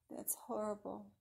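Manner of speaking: ordinary delivery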